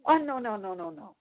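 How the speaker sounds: disbelieving no. Retold or imitated. Cop refuses to believe her age.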